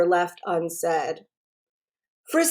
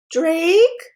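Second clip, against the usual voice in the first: higher, lightly voiced young girl; girl's voice; 8 semitones higher, 4 dB louder than usual. A narrator's reading. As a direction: voice Caitlin on the phone. Caitlin on the phone.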